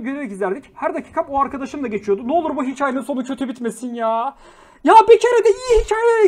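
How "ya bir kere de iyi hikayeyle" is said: high, loud fed-up cry; loud, exasperated plea; exasperated outburst. Real, not acted. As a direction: throw up your hands at the chat. Exasperated outburst at sad chat stories.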